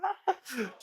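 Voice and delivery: high burst of laughter; laughing. Real, not acted. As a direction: laugh out at a friend. Laughs at gym friend.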